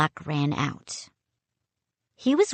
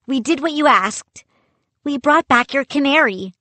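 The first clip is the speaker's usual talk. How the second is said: put-on eager boy; 7 semitones higher, 4 dB louder than usual. A narrator's reading. Boy eager to please captain.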